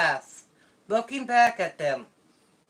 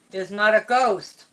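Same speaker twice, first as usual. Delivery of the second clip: usual speaking voice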